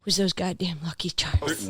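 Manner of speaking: a playful whisper on goddamn Lucky Charms; whispered curse. Pretend. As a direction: hush the curse word as a joke.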